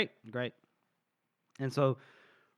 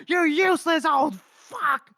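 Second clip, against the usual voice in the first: high, loud, drawn-out insult; acted furious bellow; top-of-the-lungs yell; 13 semitones higher, 5 dB louder, slower than usual. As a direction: scream it for show like an angry chief. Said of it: "yell at the top of his lungs".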